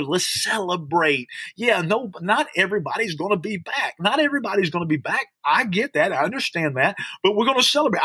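high, rousing preaching; rally the listeners to celebrate; rousing call to celebrate. Real, not acted.

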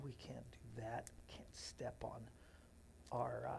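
very soft, low muttering to self; barely audible self-correction; whispered aside. Real, not acted. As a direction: drop to a whisper, talking to yourself. Muttering to himself about stepping on something.

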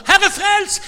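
high, loud acted shout; sudden loud plea to heaven; urgent cry for rescue. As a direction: yell it like a drowning man's prayer. Acts Peter's short desperate prayer.

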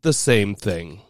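slow, low deadpan retort. Retold or imitated. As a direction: say it flat and slow. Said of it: "And I said".

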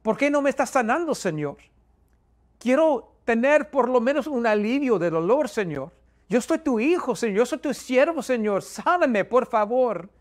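high, urgent pleading; retold tearful prayer. Suffering believer begging the Lord for healing, reenacted high-pitched tearful plea. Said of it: "empecé a orar con lágrimas en mis ojos".

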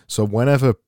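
usual speaking voice

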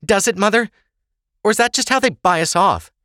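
high, loud indignant questioning; sharp challenge. Acted. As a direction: throw the doubt back at your mother. Ava sharply challenges her mother.

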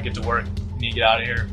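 normal talking voice

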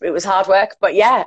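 ordinary talk

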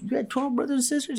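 very high, quick imitation of disbelief; mock incredulous question. Side coach questioning the quarterback's story, mock incredulous. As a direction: squeak out the question, fast and disbelieving.

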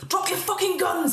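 high panicked scream at the party. Acted. Frightened man screams at the armed party, high panicked yell. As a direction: shriek the order, desperate. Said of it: "He screams at you".

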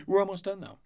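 high, loud hype-up voice; hyped announcer. Acted. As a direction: pump up the crowd.

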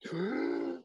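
a sudden high gasp for air; sharp gasp. Retold or imitated. Surfer gulping air before the wave hits. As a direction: suck in a huge breath.

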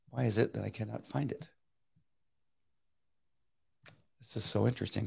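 muttering to himself. Real, not acted. Host on a live stream mutters at his broken video. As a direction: mutter at the screen as if nobody can hear.

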